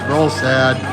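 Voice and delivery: usual speaking voice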